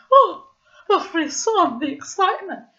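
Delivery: mock ecstatic outburst; theatrical excitement. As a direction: ham up a thrilled shiver. Narrator mocks the tremble, theatrical.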